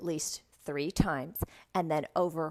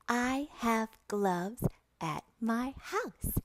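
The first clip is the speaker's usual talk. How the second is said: higher, word-by-word model sentence; slow, careful teacher voice; slow sentence modelling; 5 semitones higher, 3 dB quieter, slower than usual. Shown as a demonstration. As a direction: space out every word for repeating.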